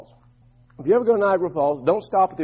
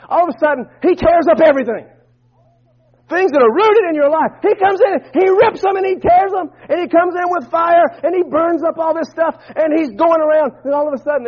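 high, driving pulpit voice; full-force preaching; 9 semitones higher, 5 dB louder than usual. Acted. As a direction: build urgency with raised, insistent pitch.

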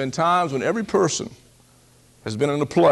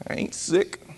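whispered mock denial; 3 semitones higher, 7 dB quieter than usual. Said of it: "I would be burning up with fever".

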